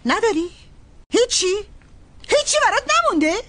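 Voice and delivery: high, fast incredulous cry; exasperated outburst. Acted. Sister learning her brother has nothing left, exasperated. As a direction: throw up your hands in disbelief.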